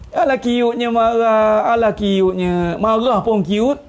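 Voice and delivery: put-on slow, drawn-out gushing over a child; sing-song fawning; cooing adoration. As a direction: coo as if the tantrum is adorable. Adults fawning over an angry child.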